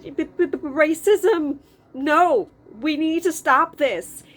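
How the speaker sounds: loud stammered high protest; a small boy sputtering then shouting no; stammering child protest. Retold or imitated.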